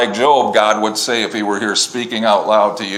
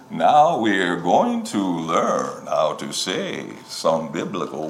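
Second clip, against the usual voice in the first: low, slow, soft narrator voice put on; mock the website voice, slow and low; imitating the pronunciation guy; 5 semitones lower, 7 dB quieter than usual. Mocking the online pronunciation guide's narrator, low and slow. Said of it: "there's a guy comes on there".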